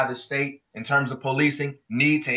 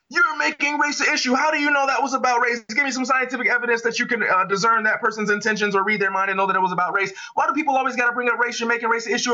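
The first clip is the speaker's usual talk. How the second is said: mock indignant debater; 6 semitones higher, faster than usual. Imitating people in endless debates.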